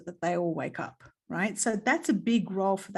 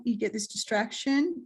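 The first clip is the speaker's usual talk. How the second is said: ordinary delivery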